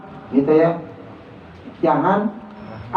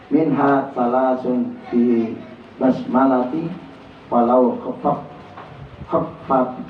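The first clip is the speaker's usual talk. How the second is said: Arabic text reading; 6 semitones lower than usual. Shown as a demonstration. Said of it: "reads Arabic text".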